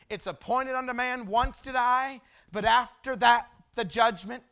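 ringing scripture proclamation; emphatic preaching. Real, not acted. Preacher proves his point from the Bible, emphatic proclamation.

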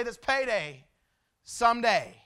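firm pulpit proclamation; old-time preacher. Retold or imitated. Pastor quotes an old preacher's message, preached out boldly. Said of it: "He'd get up and he'd just preach".

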